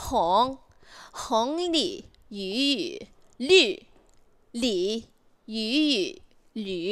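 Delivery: slow, separated model syllables "Hong", "Li", "Yu"; correct pronunciation demo. Shown as a demonstration. Tutor showing the learner the right way, slow careful syllables. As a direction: give each of "Hong", "Li", "Yu" alone and carefully. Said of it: "Coba, yang benernya gimana?".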